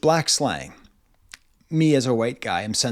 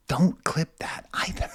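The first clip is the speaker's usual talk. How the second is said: hushed aside; slow whispered warning; 4 semitones higher, 5 dB quieter than usual. Real, not acted. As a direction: whisper it to the audience. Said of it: "whispered aside".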